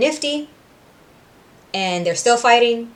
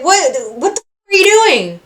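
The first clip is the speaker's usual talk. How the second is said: higher, rising into a loud, crying alarm; shocked confusion; 7 semitones higher, 5 dB louder than usual. Retold or imitated. Angel waking from hypnosis to find himself choking Cherry, high and alarmed. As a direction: snap out of a daze in horror. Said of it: "Angel's like".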